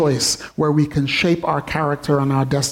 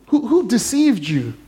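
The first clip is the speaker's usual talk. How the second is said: usual speaking voice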